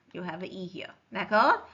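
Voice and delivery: ordinary talk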